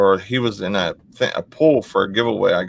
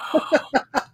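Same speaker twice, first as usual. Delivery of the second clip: high squeak. Acted. End of the call.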